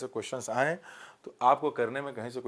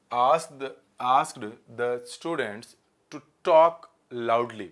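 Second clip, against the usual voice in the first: slow corrected read-out; 3 semitones lower, 4 dB louder, much slower than usual. Shown as a demonstration. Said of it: "loudly होगा कि allowed होगा".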